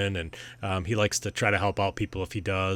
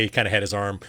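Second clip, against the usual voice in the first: usual speaking voice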